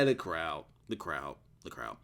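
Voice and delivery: dropping off step by step; fading repeats. Real, not acted. Repeats the complaint, trailing away.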